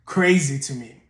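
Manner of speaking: normal talking voice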